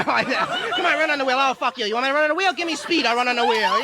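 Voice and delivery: loud, high angry retort; hamster talking back. Retold or imitated. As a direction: snap back at the scientist. Stoned hamster arguing with scientist.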